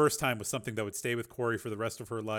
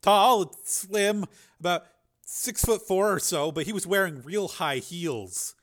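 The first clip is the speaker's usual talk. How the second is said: put-on higher, hesitant boy's voice describing an attacker; battered boy; 7 semitones higher, 6 dB louder, slower than usual. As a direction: play the hurt kid recalling details. Narrator voices injured boy Jason.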